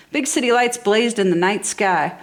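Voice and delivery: ordinary delivery, nothing marked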